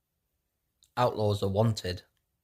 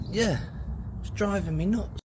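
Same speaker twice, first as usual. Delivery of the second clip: high comic guy voice; 10 semitones higher than usual. Acted.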